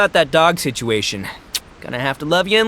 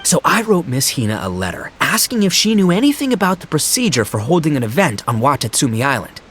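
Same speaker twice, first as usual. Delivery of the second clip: usual speaking voice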